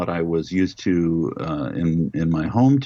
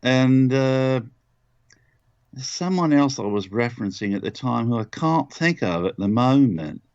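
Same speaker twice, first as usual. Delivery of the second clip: lightly held London accent, somewhat high; Whitechapel Charlie; 6 semitones higher than usual. Shown as a demonstration. Actor searches for his influences, still in a London accent. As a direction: let the bartender's London accent linger as you think. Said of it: "He's a bit of Michael Caine".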